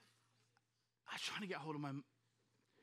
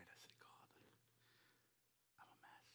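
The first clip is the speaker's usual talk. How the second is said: tearful prayer; 19 dB quieter than usual. Retold or imitated. I just cried.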